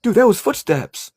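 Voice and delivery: high, laughing stammer of dudes; excited stutter. Retold or imitated. An investigator after hearing footsteps, high and stammering. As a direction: babble from fright and thrill. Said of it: "you stutter 'cause you're so excited".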